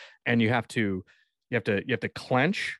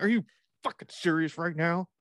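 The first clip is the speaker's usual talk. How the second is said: mock furious rant; 7 semitones higher than usual. Imagined dad yelling at tree.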